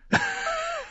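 put-on drunken bark of a laugh; a rough, short laugh; harsh laugh. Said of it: "Er lachte". (rauhes betrunkenes Lachen)

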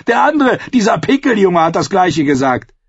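high, loud sneer; jeering yellow cloak; mocking outlaw. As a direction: scorn the pleading prisoner.

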